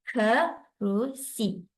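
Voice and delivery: slow, each syllable of kerusi pulled apart. Shown as a demonstration. Teacher splitting kerusi into syllables for parents, slowly. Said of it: "dalam kerusi ada tiga syllable".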